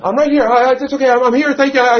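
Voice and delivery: high, loud sing-song reassurance; high acted voice. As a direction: overplay calling out I'm here.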